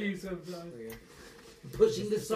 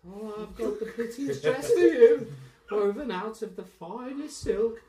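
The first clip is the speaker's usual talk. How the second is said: dresser's voice; 7 semitones higher, 5 dB louder than usual. Acted.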